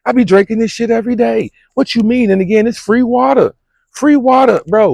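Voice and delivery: high and loud, worked up about free water; excited rant. Real, not acted. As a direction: hype it up like the best secret in town.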